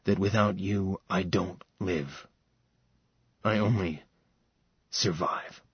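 quiet loving vow, fading away; soft, low tender vow. Acted. Max answering Sarah's love, soft and low.

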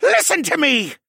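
high, loud insistent shout; urgent demand. A narrator's reading. Jenner holding Alex back, shouting urgently. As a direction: shout to be heard and obeyed.

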